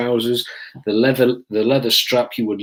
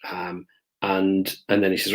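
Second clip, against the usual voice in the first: ordinary delivery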